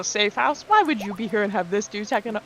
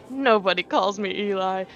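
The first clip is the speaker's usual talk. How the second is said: ordinary delivery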